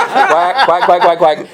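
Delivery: quacking like a beginner duck call; duck call honks. Retold or imitated. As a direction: honk each quack like a cheap call. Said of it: "So I'm blowing a duck call".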